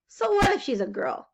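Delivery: ordinary delivery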